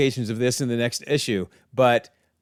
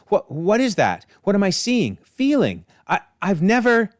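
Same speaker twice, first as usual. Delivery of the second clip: high, halting acted confusion; mock-distressed, rising pitch; bewildered stammer; 7 semitones higher than usual. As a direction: stammer upward as if overwhelmed. Cain acted out, bewildered by feelings.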